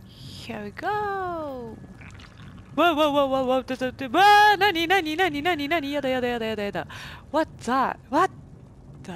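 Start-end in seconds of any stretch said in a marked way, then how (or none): 2.8-6.9 panicked. Real, not acted. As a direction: stammer in rising panic. Panicking at something in the dark.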